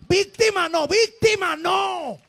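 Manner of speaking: shouted sermon climax, slow on the last víctima no; preaching at full force. Acted. Preacher shouting víctima no at the church. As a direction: thunder it at the congregation.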